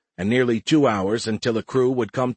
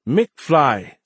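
very slow, high, bewildered alien voice; lost young alien; 8 semitones higher, 4 dB louder, much slower than usual. A narrator's reading. Alien missing the humans' joke, lost and slow. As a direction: sound out the strange name slowly. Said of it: "Nert asked, lost".

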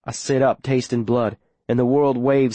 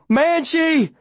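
high-pitched calling out; urgent call; 12 semitones higher than usual. A narrator's reading. Boy leaning out of boat to his dog, high urgent call. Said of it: "and I'm calling".

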